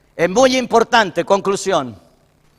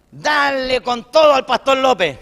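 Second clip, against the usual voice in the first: higher, drawn-out mocking quote; mock radio attack; 6 semitones higher than usual. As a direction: stretch it out like a heated radio rant. Imita los ataques en la radio. (imitando un ataque exagerado)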